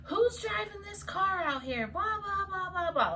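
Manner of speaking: high-pitched mock yell; yelling officer. Angry cop at the door demands the driver, mock yelling. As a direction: imitate an irate officer, high and rattling. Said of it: "like, yelling at me".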